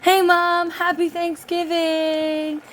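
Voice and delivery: put-on high, loud, bright kids' greeting; imagined kids' greeting. Children greeting their mother at Thanksgiving, high and loud. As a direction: call out like a child coming home. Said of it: "they're like".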